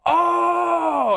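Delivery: long, high-pitched mock-shocked "oh"; imitated outraged heckler; scandalized cry. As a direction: gasp out loud like an offended audience member. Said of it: "some lady goes".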